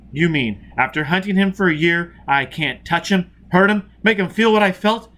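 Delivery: rising to high and loud; frustrated fury. A narrator's reading. Foyle robbed of his revenge, building fury. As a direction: let the rage build.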